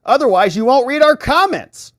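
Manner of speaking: loud, high-pitched hammed-up yell; mock shouting. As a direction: belt out the viewer's complaint. Acting out a viewer's punchline.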